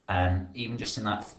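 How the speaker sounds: normal talking voice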